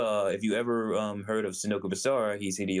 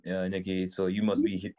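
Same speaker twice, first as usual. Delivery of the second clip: ordinary delivery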